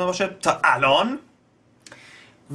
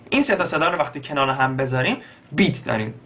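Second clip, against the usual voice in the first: ordinary talk, nothing marked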